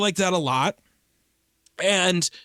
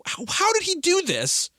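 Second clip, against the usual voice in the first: higher-pitched incredulous question; amazed disbelief; 10 semitones higher than usual. Retold or imitated. Podcaster recalling a bazooka hitting a car, amazed disbelief. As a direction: marvel at how it was pulled off.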